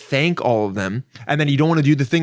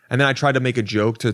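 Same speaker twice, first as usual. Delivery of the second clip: ordinary talk, nothing marked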